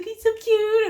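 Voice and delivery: husband sweet-talking the cat; cooing at a pet. Retold or imitated. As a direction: coo it like calling a kitty.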